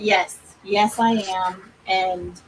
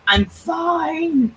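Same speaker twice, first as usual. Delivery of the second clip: higher, strained mock insistence; insisting I'm fine; 8 semitones higher than usual. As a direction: fake it's all fine. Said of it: "I'm like".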